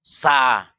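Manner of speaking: SA with a thin, open A flowing outward; sa tipis meluncur. Shown as a demonstration. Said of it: "Tapi kalau bilang, sa". (SA dibaca tipis, suara meluncur keluar)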